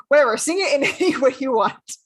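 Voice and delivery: amused at your own bad singing; laughing. Real, not acted. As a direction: talk through giggles.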